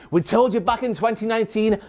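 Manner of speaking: usual speaking voice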